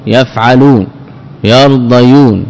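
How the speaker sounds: normal talking voice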